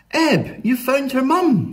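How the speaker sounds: high, excited exclamation; delighted Flo. A narrator's reading. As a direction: cheer the dog's success, pitch up. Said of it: "said Flo".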